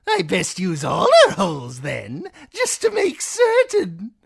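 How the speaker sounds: high, loud, mocking jester voice; cruel jester. A narrator's reading. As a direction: taunt the wounded man with glee. Shagwell voiced.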